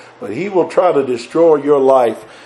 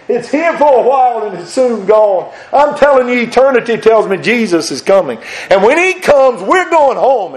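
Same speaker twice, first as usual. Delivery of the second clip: high, loud preacher's climb; preaching at full force; 8 semitones higher, 3 dB louder than usual. Acted. Preacher promising heaven to the church, at full force. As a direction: lift the congregation with a rising preach.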